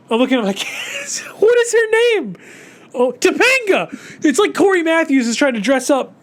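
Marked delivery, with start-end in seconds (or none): 1.2-2.4 high, slow desperate plea for the name; frantic recall. Real, not acted. Reviewer straining to recall the actress, high slow plea. 2.9-3.9 high bright eureka; sudden realization. Real, not acted. Reviewer finally remembering the name, high sudden realization.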